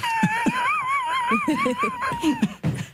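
high, shrieking laughter; hard laughter. Real, not acted. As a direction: laugh until it hurts. Teller breaks into laughter.

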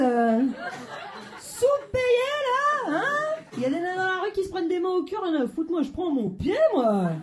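high, squealing over-the-top ecstasy; hammed-up blissful housewife. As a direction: ham up a giddy submissive wife. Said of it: "elle est là". (ménagère extatique surjouée)

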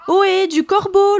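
very high, loud call; hailing. A narrator's reading. Siren hailing a passing crow, calling out. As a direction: holler up at someone flying past. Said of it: "le hèle la sirène".

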